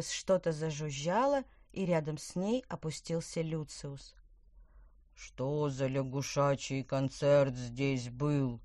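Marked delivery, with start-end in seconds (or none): 5.1-8.6 slow, lower, curious newcomer; curious Lucius. A narrator's reading. Lucius lands and asks about the frog concert, slow and lower. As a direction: drawl out a curious question. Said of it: "с любопытством спросил он".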